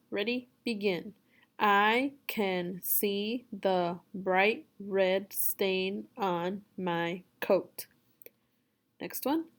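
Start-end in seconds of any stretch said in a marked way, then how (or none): 1.6-7.9 very slow, each word set apart; word-by-word reading. Shown as a demonstration. Teacher reading for a student to repeat, word by word. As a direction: read it slowly, pausing after every word. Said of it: "You just repeat after me".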